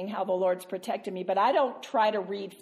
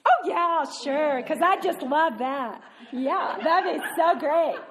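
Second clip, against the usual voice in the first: high, laughing mock enthusiasm, louder; sarcastic laughing; 6 semitones higher, 3 dB louder than usual. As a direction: gush with fake delight while laughing. Sarcastic reply about debriefing.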